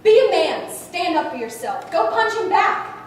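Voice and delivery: loud, high, commanding shout; forceful bullying command; barked order. Acted. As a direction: order someone to toughen up. Acted orders to toughen up.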